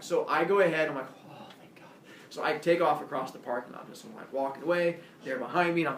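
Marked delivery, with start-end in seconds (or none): 1.3-1.9 breathy whispered relief under the breath; relieved whisper. Retold or imitated. Walking away from the cops, a relieved whisper. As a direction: whisper it so they can't hear.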